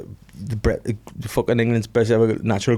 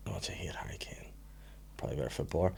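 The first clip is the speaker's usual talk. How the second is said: hushed, breathy under-the-breath comment; real low whisper; whispered aside; 6 semitones lower, 18 dB quieter, much faster than usual. Real, not acted. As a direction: say it half to yourself. Drops to a whisper.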